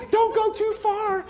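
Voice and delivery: high, slow, fretting call; worried nurturing parent. Acted. Nurturing parent calling after the wandering child, high and worried. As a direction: sing out a worried warning to the wanderer. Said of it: "Nurturing parents going".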